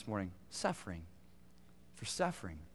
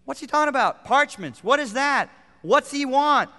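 loud, high, insistent questioning; forceful questions; 10 semitones higher, 11 dB louder than usual. Real, not acted. Preacher demanding the congregation think, loud questions. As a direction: press the room for an answer.

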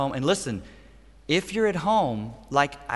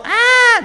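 short high, loud shriek; imitated child's scream; 12 dB louder than usual. Preacher playing his daughter interrupting the scolding, high scream. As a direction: cut in with a toddler's screech. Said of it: "She would scream".

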